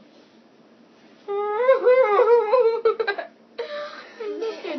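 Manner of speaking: shrieking with laughter; loud laughing fit. Real, not acted. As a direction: burst out in a long loud laugh. Blindfolded makeup gone wrong.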